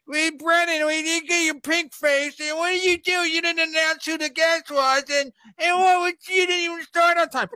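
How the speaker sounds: mock the nagging fans in a shrill high voice; whiny complaining viewer. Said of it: "the people are like".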